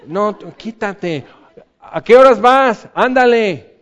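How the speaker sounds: high, loud hurry-up brush-off; impatient shooing. Pretend. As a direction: push the intruder out of the way. Shooing someone aside in a crowd.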